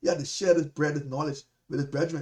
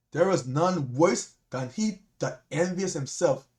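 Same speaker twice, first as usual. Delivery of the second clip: slow reading; slower than usual. Shown as a demonstration. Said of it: "Let's read that again".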